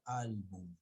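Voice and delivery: álbum drawn out on its opening syllable; álbum, long first syllable; stress on first syllable. Shown as a demonstration. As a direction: stretch the AL of álbum. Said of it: "La mayor fuerza de voz está en la penúltima sílaba".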